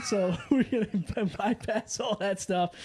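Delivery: words broken by laughter; laughing. Real, not acted. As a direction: try to talk through giggles. Host cracking up mid-intro.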